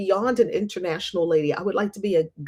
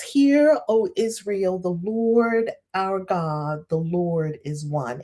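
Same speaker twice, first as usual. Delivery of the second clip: slow recited prayer; slower than usual. Retold or imitated. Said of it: "and I was like".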